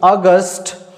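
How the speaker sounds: अगस्त with a clear hard final ट; English August. Shown as a demonstration. Teacher to class: English अगस्त with a hard ट. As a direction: repeat अगस्त with a crisp ट. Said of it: "तो इसे ध्यान रखेंगे".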